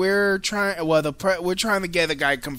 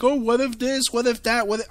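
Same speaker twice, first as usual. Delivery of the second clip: lightly mocked higher voice; a hint of everyone fretting, pitched up; mimicking worriers; 8 semitones higher, faster than usual. Host quotes everyone's what-ifs, lightly mimicking.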